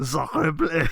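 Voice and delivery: put-on playful French accent. Teasing the co-host, a mock French accent. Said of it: "You do the French accent, too".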